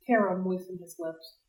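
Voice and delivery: ordinary delivery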